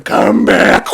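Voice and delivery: loud, harsh wailing roar; hammed-up tearful dragon bellow; crying dragon. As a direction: roar it through monster sobs. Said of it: "she starts to cry".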